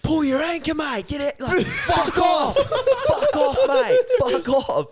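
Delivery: very high, loud hammed-up shout; mock angry yelling. Yelling at old mate to pull anchor, mock angry. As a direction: bellow across the water, voice way up high.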